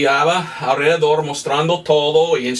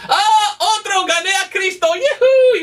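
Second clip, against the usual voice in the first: much higher, gleeful whoop with a laugh; mocking boast; 14 semitones higher than usual. Mocking preachers cheering another soul won, hammed-up gleeful whoop. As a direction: parody a smug cheer at each convert. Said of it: "y van jactando".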